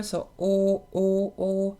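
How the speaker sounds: O held with a wide open mouth, rounded and long; a round open O shaped by a big embouchure; O vowel demo. Shown as a demonstration. Voice coach shows viewers the O vowel with a wide mouth. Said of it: "O tends to have a large embouchure".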